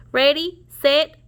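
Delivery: rising loud race call; starter's shout. Acted. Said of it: "Pete shouted".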